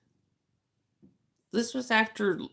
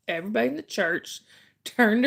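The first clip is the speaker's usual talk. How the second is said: ordinary talk, nothing marked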